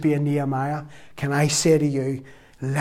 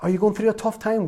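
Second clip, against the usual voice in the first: ordinary delivery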